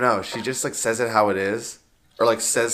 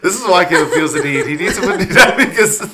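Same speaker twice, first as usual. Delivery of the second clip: laughing; 6 semitones higher, 7 dB louder than usual. Real, not acted. Laughing apology.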